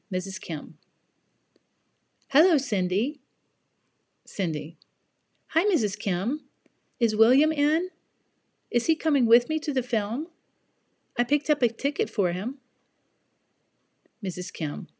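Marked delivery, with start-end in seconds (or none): 2.3-3.2 friendly mother. Acted. Mrs Kim voiced in dialogue. 5.5-12.6 put-on bright eager girl voice, higher; chirpy excited teen friend; cheerful young girl. Cindy voiced in dialogue.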